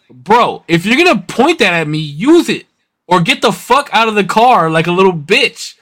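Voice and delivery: retold angry dare. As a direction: dare them, as if mid-confrontation. Retells daring the driver to spray.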